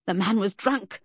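loud, furious dismissal; stormy. A narrator's reading. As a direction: storm at them in anger. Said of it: "she stormed".

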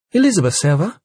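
high rising surprised question; surprised with a hint of suspicion; surprised, suspicious. A narrator's reading. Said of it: "a tone in her voice that suggested not only surprise".

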